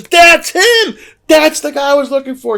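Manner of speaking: very high, loud thrilled cry; excited shout. Retold or imitated. Lita seeing Father Evil on the coffee bag, shrieking. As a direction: scream it out in delight. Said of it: "She goes".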